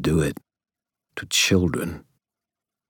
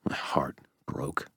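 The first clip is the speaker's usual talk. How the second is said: near whisper, much softer; heartbroken hush; 3 semitones lower, 11 dB quieter than usual. A narrator's reading. Dresden hearing his grandfather's accusation, heartbroken and whispering. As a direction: let it drop away into a whisper.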